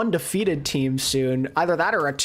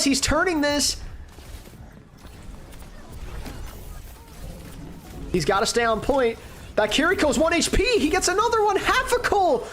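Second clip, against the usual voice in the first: high-pitched, mounting excitement; excited play-by-play; 9 semitones higher than usual. Real, not acted. Caster watching Half a Cool turn the fight, real excitement. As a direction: call the comeback live, pitch climbing way up. Live comeback during the match.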